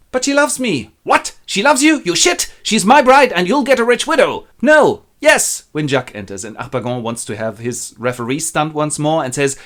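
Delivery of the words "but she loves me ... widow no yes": high, loud angry back-and-forth; heated quarrel. Acted. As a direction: fire indignant retorts at top pitch. Father and son quarrel over bride.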